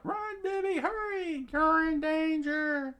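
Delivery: put-on very high falsetto child voice; screaming kid. A narrator's reading. Kid warning friend of danger during the fight, screaming. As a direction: squeal an urgent warning, last words stretched. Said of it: "one of the kids screamed out".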